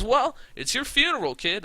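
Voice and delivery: high, loud smug threat; cocky threat. Retold or imitated. Champion threatening the challenger, high smug threat. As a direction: sneer a threat at the kid. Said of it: "He goes".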